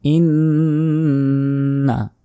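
long hummed n in ইন্না; very drawn-out nasal ইন্না; ghunnah nasal hold. Shown as a demonstration. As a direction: hold the n of ইন্না through the nose. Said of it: "ইন গুণা হবে".

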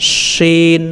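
শিন with a sh sound and long ee; palatal letter sound. Shown as a demonstration. Teacher showing learners the letter, palatal drawn-out শিন. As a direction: mid tongue to palate, hold শিন. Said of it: "মধ্যখান উপরে তালুর সাথে".

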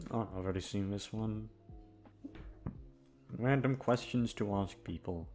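normal talking voice

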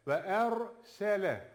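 slow chanted recitation; Quran recitation. Shown as a demonstration. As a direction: recite the verse slowly, with tajweed. (yavaş tecvidli tilavet)

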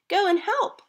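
high, loud urgent call; shouting. Acted. Laura sending Bob to help with the falling kite, high urgent shout. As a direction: shout an order across the beach. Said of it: "Laura shouted to Bob".

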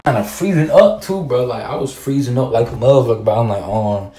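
loud flustered storytelling. Real, not acted. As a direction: raise your voice, flustered. Loud, stuttering telling.

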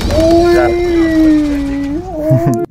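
high, drawn-out squealing laugh; long high laugh. Real, not acted. Long high laugh after the play.

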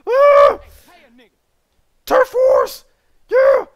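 very loud victory whoops; a gamer roaring at a turf war; hype yelling. Real, not acted. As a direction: yell like you just won. Hyped shouting in a turf war.

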